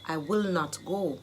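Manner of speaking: usual speaking voice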